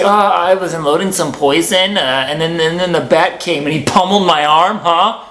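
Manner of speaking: loud sarcastic mock explanation; mock exasperated retelling. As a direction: blurt it out like a fool at the hospital desk. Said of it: "I say".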